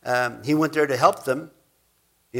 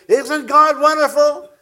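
much higher, louder, slow gushing praise; 11 semitones higher, 9 dB louder, slower than usual. Retold or imitated. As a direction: overdo the joyful believer. Mocking a fickle believer's high.

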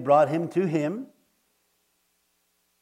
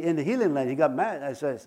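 usual speaking voice

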